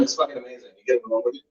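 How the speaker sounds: ordinary delivery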